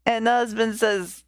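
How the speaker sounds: usual speaking voice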